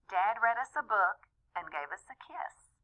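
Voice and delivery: plain reading voice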